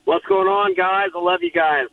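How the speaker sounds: ordinary talk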